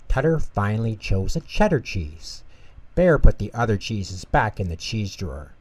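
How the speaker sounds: usual speaking voice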